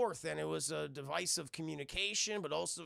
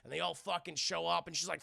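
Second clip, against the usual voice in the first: usual speaking voice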